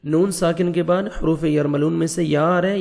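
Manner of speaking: ordinary talk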